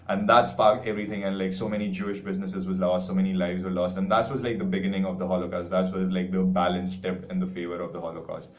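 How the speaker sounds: normal talking voice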